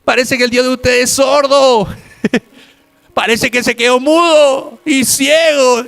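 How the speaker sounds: mocking taunt. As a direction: jeer at the false prophets' god. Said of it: "y se reía".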